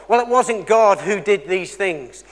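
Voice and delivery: normal talking voice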